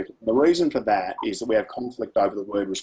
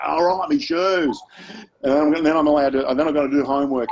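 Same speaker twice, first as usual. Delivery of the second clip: mock-sulky son listing what he must do; grudging teen; 5 semitones higher, 4 dB louder than usual. Son answering mum at the door, mock sulky.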